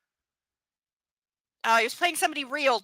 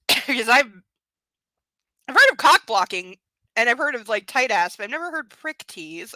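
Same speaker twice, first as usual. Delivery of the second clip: ordinary delivery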